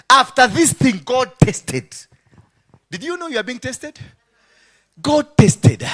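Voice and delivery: loud, high, insistent preacher's call; preaching at full force. Real, not acted. Preacher booming the test to his congregation. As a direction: hammer home that God tests.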